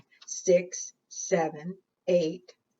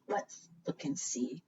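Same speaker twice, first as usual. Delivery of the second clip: softly whispered "Let's look and see."; secretive whisper to the children; suspenseful whisper; 3 semitones lower, 11 dB quieter than usual. Acted. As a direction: hush the room before the reveal. Suspense before lifting paper.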